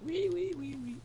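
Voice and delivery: very high, soft squealing wee wee wee; mock pig squeal of a child at the dentist; piggy squeal. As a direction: squeal like a frightened little pig. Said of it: "screaming like a fucking, a little piggy".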